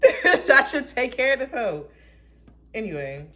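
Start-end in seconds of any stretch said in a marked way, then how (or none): 0.0-1.9 high, loud, laughing through it; giggling sassy repeat. Acted. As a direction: laugh while repeating the joke. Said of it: "repeated laughing".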